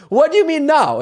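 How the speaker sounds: high, loud comic disbelief; incredulous outburst. Retold or imitated. Tourist challenging the taxi driver's answer, incredulous high shout.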